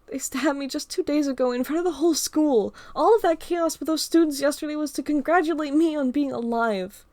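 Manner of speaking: put-on girl confiding about bullies; Emma recounting. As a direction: tell the story of the attacks in a girl's voice. Emma telling of bullying.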